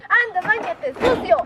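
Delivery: high scolding cry; scolding shout. Acted. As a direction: yell at him to go wash. Family scolds dirty creature.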